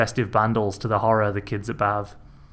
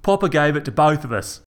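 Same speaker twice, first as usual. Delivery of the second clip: louder angry insistence; angry retort; 5 semitones higher, 4 dB louder than usual. A narrator's reading. Brother arguing over their father's pole. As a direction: insist on it hotly.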